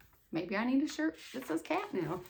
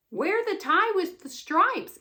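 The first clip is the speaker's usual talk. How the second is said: put-on cheerful kid; 8 semitones higher, 4 dB louder than usual. Emma voiced in story.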